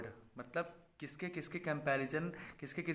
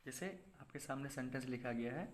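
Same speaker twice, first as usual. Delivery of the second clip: normal talking voice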